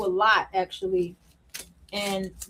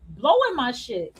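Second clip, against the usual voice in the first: ordinary talk, nothing marked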